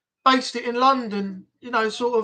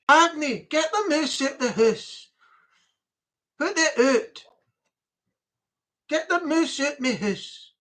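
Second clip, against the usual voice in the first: playful mock Scots; Scottish accent; 5 semitones higher than usual. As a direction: put on a broad Scottish accent. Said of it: "As they say in Scotland".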